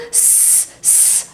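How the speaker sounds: voiceless hissing s; voiceless s sound; hissed s. Shown as a demonstration. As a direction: let the s hiss out. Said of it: "phonics S sound".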